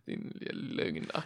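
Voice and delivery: low, voiceless mutter under the breath; retold insult in a near whisper; muttered retort. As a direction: mutter it almost soundlessly, as a retold aside.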